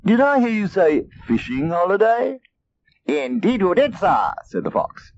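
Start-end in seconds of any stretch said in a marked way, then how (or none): 0.1-2.4 much higher sly cat voice; a sneaky cat perking up; sly eager cat; eager Custard. Acted. 3.1-4.3 a smooth gentleman fox; polished fox greeting; suave fox. Acted.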